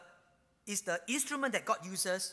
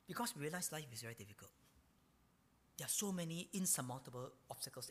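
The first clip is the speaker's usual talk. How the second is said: softer voice; 4 semitones lower, 8 dB quieter than usual. Shown as a demonstration. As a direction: drop to a much softer, quicker voice. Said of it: "the volume of voice becomes softer and softer".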